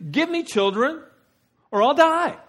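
demanding, high and loud; acted ultimatum that builds to a cry; Rachel. Rachel confronting her husband Jacob, high demanding outburst. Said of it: "Rachel cops an attitude here".

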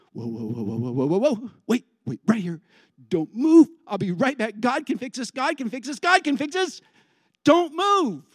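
acted alarm, rising in pitch; urgent, commanding husband. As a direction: halt her, then rally frantically. Acts a man stepping in.

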